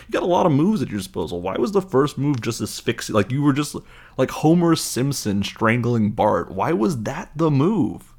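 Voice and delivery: high, emphatic comic exasperation; incredulous, stretched-out questioning; baffled. Real, not acted. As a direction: rant in disbelief at the choice. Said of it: "I'm just baffled by that one".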